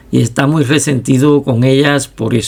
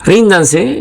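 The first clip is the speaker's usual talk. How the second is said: mild, higher-pitched call to surrender; narrated shout; 6 semitones higher than usual. A narrator's reading. Lieutenant calling out to unseen gunmen, understated narrated shout. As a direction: lift the pitch for a restrained command. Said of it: "gritó una vez más".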